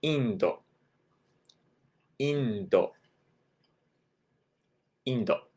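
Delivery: Indo said in-do; インド; Japanese Indo. Shown as a demonstration. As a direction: Indo with clean Japanese vowels. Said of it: "India is gonna be".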